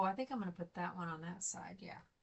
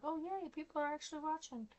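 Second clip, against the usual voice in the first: bright little imitated cheer, pitched higher; mock excited; 7 semitones higher than usual. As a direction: act out getting excited about viewers. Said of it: "I'm like".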